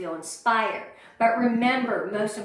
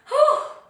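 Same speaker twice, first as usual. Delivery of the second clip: loud high sigh; 8 dB louder than usual. Real, not acted. After sharing painful past.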